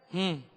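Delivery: low, absent-minded "hmm"; indifferent grunt. Acted. Busy father ignores son's goodbye, low soft grunt. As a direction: grunt softly without paying attention.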